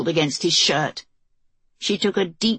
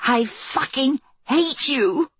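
hissed; 7 semitones higher, slower than usual. Acted. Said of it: "she hissed".

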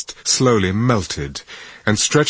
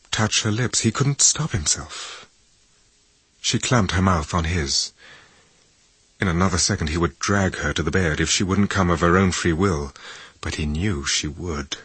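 ordinary delivery

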